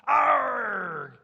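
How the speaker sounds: loud, drawn-out "arrr" growl of a bully; angry pirate roar. Shown as a demonstration. Teacher showing kids the cue for Saul, a big pirate roar. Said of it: "I want you guys to go".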